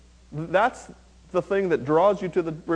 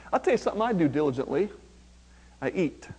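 ordinary delivery

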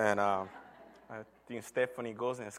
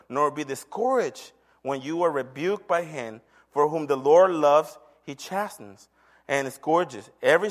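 loud, emphatic pulpit reading; forceful, deliberate Bible recitation; scripture reading; 8 dB louder than usual. Acted. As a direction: proclaim the verse with weight on key words. Reads scripture aloud from the pulpit.

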